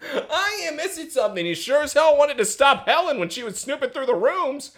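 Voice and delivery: high-pitched indignant rant; hammed-up sarcastic tirade; mock angry. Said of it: "[name] is just angry".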